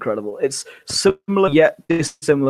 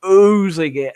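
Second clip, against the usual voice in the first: gushing; 6 semitones higher, slower than usual. Real, not acted. Fan gushing.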